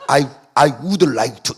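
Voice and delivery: very slow, each word spelled out; slow full form. Shown as a demonstration. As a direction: drag out every word of I would like to. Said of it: "이래 안 하잖아요".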